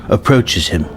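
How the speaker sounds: usual speaking voice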